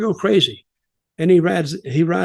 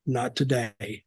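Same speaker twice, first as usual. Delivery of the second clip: sinking almost to a hush, low and ominous; low quiet Undertaker menace; 4 semitones lower, 10 dB quieter, slower than usual. Retold or imitated. Retelling Undertaker's promo line to the co-host, a low, hushed threat. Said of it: "You'll be a big star one day".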